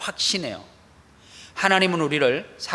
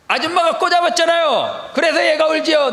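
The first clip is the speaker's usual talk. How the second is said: higher, loud accusing shout; indignant outburst; 12 semitones higher, 7 dB louder than usual. Retold or imitated. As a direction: call her out in front of everyone. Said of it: "내가 이랬어요". (지하철에서 엄마를 꾸짖는 높고 큰 외침)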